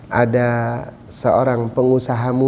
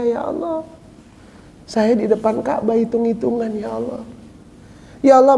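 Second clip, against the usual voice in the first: remorseful plea; 13 semitones higher than usual. Acted. Repentant prayer at dusk.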